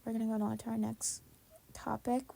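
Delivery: usual speaking voice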